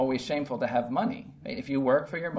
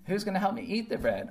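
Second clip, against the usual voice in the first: voicing the little red hen; 7 semitones higher than usual. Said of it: "the little red hen story".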